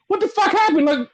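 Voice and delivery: high-pitched incredulous exclamation; baffled outburst. Retold or imitated. Fan reacting to a hated nickname, baffled. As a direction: blurt it out high, utterly baffled.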